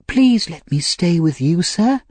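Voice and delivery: put-on high-pitched boy begging to stay; pleading Oliver. A narrator's reading. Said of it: "cried Oliver".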